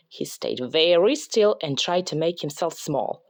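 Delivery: ordinary delivery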